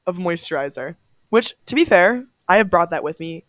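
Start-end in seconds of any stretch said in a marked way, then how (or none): none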